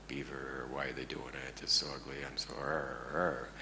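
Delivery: deep, quiet, growling grumble; grumpy grumble. Retold or imitated. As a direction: growl it like a grumpy, annoyed man. Grumbling about the beaver damage.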